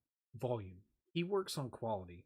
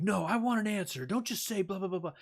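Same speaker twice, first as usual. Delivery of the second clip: much higher and louder, demanding; boss yelling; 7 semitones higher, 5 dB louder than usual. Retold or imitated. Boss yelling at the employee for an answer, high and loud. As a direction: bark it like an angry boss. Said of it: "getting yelled at by a boss".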